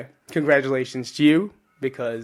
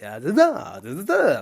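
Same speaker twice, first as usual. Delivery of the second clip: 1 mock Italian. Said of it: "New Jersey Italian and Italian Italians".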